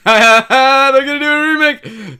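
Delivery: excited. Real, not acted.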